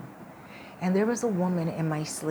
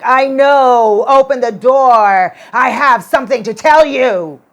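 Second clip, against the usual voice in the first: ghost woman's demand; 6 semitones higher, 16 dB louder than usual. Retold or imitated. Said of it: "The same voice as the woman in my dream".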